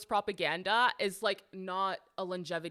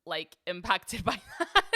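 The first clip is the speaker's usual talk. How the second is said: laughing; 7 dB louder than usual. Real, not acted. Laughs while making the point.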